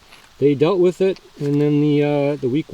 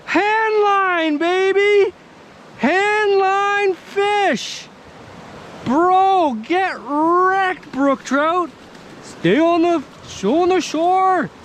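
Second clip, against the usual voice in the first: high, ecstatic yelling over a catch; thrilled, shouting with joy; excited shouting; much slower than usual. Real, not acted. Landing a big fish.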